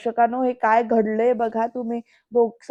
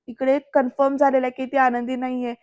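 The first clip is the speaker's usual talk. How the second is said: usual speaking voice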